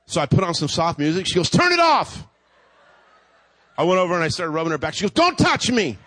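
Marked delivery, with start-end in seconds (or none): 1.5-2.2 high, loud curt order; snapping at him. Retold or imitated. Wife in labour ordering husband to kill the music, high snap. 5.1-6.0 snapping. Retold or imitated. Wife in labour warning husband off her back, high snap.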